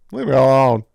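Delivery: mock-angry shout after the whisper. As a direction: bark it out as a joke. Mock plea, whisper then shout.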